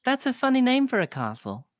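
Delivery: put-on a small girl owning up to the barkeep; little girl apologizing for the bird.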